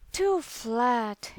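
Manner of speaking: ordinary delivery, nothing marked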